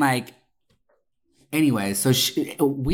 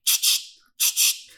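unvoiced ch-ch-ch noise; creepy chittering. Server making a strange noise at the table. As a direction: make an eerie chattering sound. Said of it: "he goes".

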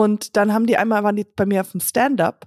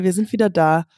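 ordinary delivery

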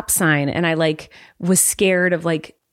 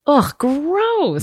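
disgusted groan at the brand; annoyed ugh; 7 semitones higher than usual. Real, not acted. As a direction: scoff it with distaste. Disgust at Abercrombie and Fitch.

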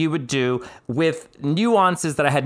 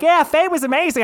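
gushing friends; 12 semitones higher, 4 dB louder than usual. Retold or imitated. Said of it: "All my friends were like".

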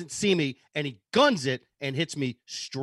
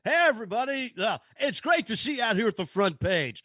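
high, bright emcee greeting; cheesy radio host; 5 semitones higher than usual. Retold or imitated. Radio trivia host greeting the crowd, hammed up. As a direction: pump up the crowd like a DJ.

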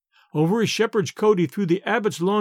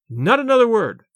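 raised, high-pitched royal command; commanding cry; 5 semitones higher, 5 dB louder than usual. A narrator's reading. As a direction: cut him off loudly, as a king. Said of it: "cried the king".

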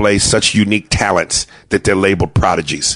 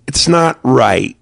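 prim son; 6 semitones higher, slower than usual. Retold or imitated. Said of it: "He goes".

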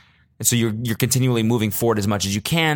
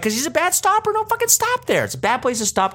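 worked-up rant. Retold or imitated. Ranting at the crowd behind, high exasperated squeal. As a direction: squeal it out, fed up and laughing. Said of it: "And I'm like".

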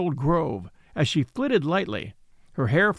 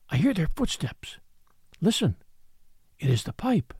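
breathless whisper. Acted. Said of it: "she whispered breathlessly".

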